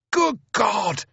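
very high, booming cry; Speedy's astonished roar. A narrator's reading. Huge bearded officer greeting his wounded friend, roaring. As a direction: roar it out in amazement. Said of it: "cries Speedy".

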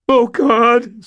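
very high, loud moan; frightened moan. Acted. Stan moans in fear at the shattered freezer. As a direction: moan in terror. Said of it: "Stan moaned".

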